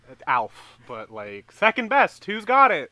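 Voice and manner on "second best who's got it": excited shout. Pretend. Hyping the question.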